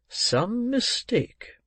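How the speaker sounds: very slow, high swooping voice; doubtful old gentleman. Acted. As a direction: stretch the words out, pondering. Old gentleman dismisses the matter.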